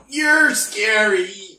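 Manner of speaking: high, loud, slow mock fright; mock scared. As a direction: ham up being scared. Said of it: "you're just like".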